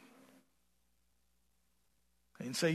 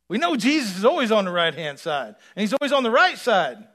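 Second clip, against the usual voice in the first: loud, higher proclamation; preaching at full force; 7 semitones higher, 15 dB louder than usual. Acted. Preacher proclaiming Jesus on the right side, loud. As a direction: declare it with conviction.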